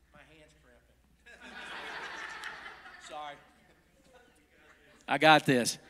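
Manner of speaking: plain reading voice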